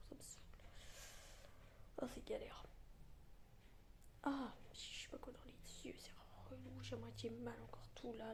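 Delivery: soft unvoiced whisper throughout. Real, not acted. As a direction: whisper so nobody wakes up. Early morning whispered vlog.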